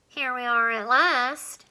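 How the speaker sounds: slow, lightly squeaky little alien; a tiny alien arriving, slowly savouring it. Acted. Alien in Alan's nose announces its arrival, lightly squeaky. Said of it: "The squeaky voice was coming from inside".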